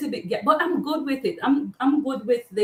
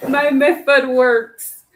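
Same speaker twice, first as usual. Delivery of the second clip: ordinary talk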